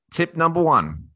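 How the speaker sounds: ordinary delivery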